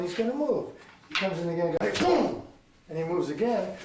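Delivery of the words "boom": high-pitched boom; sudden vocal burst; imitated impact. Shown as a demonstration. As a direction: make the sound of the hit landing. Imitates the strike landing.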